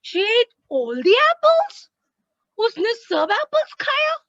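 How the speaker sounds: high, loud, slow rising question; shocked asking, rising at the end; surprised question. Shown as a demonstration. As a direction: ask it in disbelief. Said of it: "say the sentence like this".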